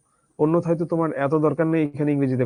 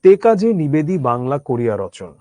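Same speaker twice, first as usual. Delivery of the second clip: reciting verse; 5 dB louder than usual. Acted. Quoting a poem line.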